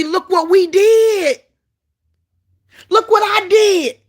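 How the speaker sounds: slow, high, loud mocking boast; mock boaster. Preacher mocking people who take God's credit, loud and slow. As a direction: ham up a proud braggart.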